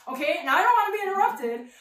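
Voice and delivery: normal talking voice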